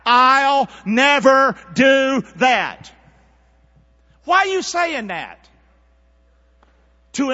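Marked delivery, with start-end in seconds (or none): 0.1-2.9 loud, high, word by word; loud call-and-repeat. Shown as a demonstration. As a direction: lead the crowd slowly at full voice. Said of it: "say it loud".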